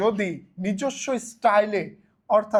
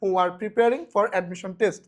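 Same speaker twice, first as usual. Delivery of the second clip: slow full reading of the solved sentence; much slower than usual. Shown as a demonstration. Teacher reading the complete answer sentence slowly. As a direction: read the whole answer out deliberately. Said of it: "তাহলে".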